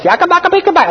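high-pitched rapid mock Cebuano gibberish. Preacher showing how foreign Cebuano sounds to him, high mock Cebuano. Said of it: "and speak in Cebuano".

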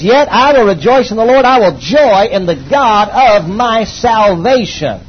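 high, loud, slow proclamation; fervent scripture. Acted. Pastor proclaiming the prophet's words, fervent and loud. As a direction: ring out the verse like a triumph.

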